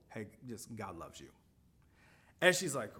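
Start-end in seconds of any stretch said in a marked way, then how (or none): 0.1-1.3 very soft, low mumble; mumbled. Retold or imitated. Reluctant shopper telling the cashier God loves her, mumbled. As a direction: mumble it quickly under your breath, barely audible. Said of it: "and I just mumble".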